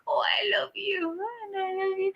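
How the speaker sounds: high, soft gushing imitation; hammed-up tipsy adoration; mock lovey-dovey. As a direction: coo it high and quietly, imitating a tipsy sweetheart. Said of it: "he gets all fucking lovey-dovey".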